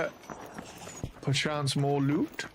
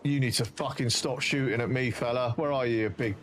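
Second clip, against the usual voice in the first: swearing tirade; 2 semitones lower, 4 dB quieter than usual. Real, not acted. Raging at spiders shooting him.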